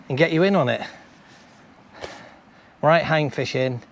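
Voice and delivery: ordinary delivery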